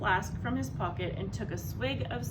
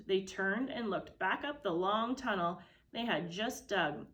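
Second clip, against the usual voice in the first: usual speaking voice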